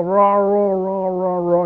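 imitating a possessed man's raw growl; mock demonic growl. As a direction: snarl it out like the man with devils. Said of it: "and he'd go".

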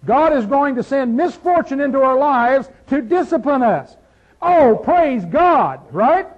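loud, rising preacher's cry; preaching at full force. Acted. Pastor exhorting the congregation, full-force preaching. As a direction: drive it home to the church.

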